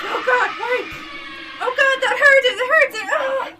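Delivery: high panicked screams for help; terrified screaming. Acted. Mike screaming as the creature bites him. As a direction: shriek like something has your arm. Mike being eaten alive.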